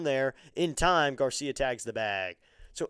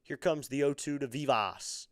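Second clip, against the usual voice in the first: ordinary delivery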